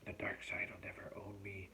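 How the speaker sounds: near-whispered private vow; vowing to himself. A narrator's reading. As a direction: whisper a solemn promise to yourself. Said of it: "Derek vowed to himself".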